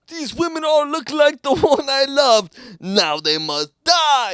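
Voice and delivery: loud, high mock Russian villain; hammed-up Russian vow of revenge; exaggerated Russian accent, shouted, slowing down; Victor. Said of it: "he's probably like".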